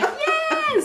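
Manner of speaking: high, loud squeal of yes; delighted yes. Retold or imitated. Answering strangers who call her baby girl, delighted. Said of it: "I'm like".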